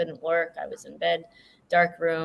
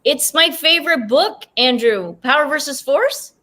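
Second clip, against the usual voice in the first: excited; 8 semitones higher, 6 dB louder than usual. Real, not acted. Delighted to share a favorite book.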